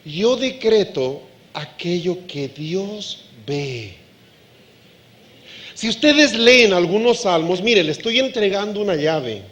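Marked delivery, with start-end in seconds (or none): 0.0-3.9 low, slow and weighty, like a proclamation; slow, grave declaration; slow solemn decree. Shown as a demonstration. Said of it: "Voy a decretar".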